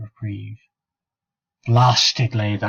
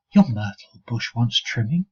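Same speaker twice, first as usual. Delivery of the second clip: ordinary delivery, nothing marked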